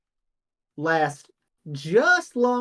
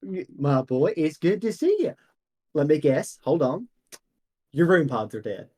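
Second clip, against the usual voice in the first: lightly voiced jolly shopkeeper, welcoming; a hint of a folksy old shop owner. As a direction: greet the old customer like a cheery merchant.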